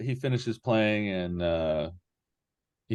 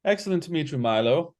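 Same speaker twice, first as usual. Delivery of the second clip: taken aback; 8 semitones higher than usual. Acted. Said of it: "he's sort of taken aback".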